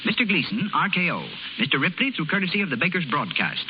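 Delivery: normal talking voice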